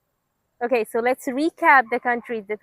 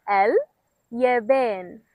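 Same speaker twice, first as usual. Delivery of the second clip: slowly; much slower than usual. Shown as a demonstration. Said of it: "If you guessed Japan".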